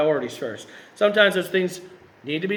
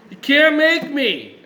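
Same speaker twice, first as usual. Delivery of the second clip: put-on high, loud, drawn-out childish whine; whining little baby; 8 semitones higher, 6 dB louder, slower than usual. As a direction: protest like a whiny little kid. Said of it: "whining like a little baby".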